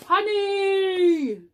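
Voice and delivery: high, loud, long delighted "Honey!"; bursting with greed and joy; overjoyed bear shout; excited cry. A narrator's reading. Norman sees the honey.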